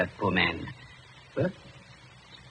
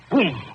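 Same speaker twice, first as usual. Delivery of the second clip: a short mock rifle shot, a touch higher; rifle boom; 7 semitones higher than usual. Doctor imitates express rifle for the young man, mock gunshot boom. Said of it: "It has a sound".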